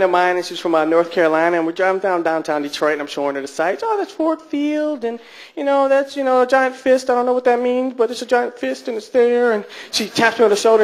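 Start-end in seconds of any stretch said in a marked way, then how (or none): 3.9-9.5 higher-pitched, chatty sightseeing voice; tour guide patter. Retold or imitated. Driver showing a friend downtown Detroit, higher tour guide patter. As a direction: point out the sights in a high voice.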